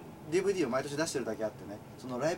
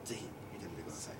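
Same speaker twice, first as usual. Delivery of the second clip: breathy whisper, voiceless; 8 semitones lower, 12 dB quieter than usual. Real, not acted. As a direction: whisper the plug softly. Whispered plug for the DVD.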